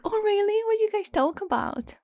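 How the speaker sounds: very high, nasal mock interest; high-pitched put-on curiosity; imitating nasal friend. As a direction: coo it like the annoying friend. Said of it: "her voice is very nasal".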